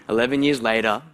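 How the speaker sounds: ordinary talk, nothing marked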